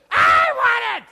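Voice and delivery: very high, loud bratty demand; child shouting. Acted. Child in the store yelling back at his mother, high and loud. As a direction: yell it like a spoiled kid. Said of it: "And he goes".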